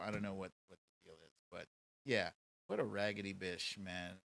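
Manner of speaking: ordinary talk, nothing marked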